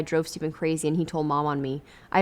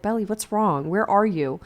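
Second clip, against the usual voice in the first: usual speaking voice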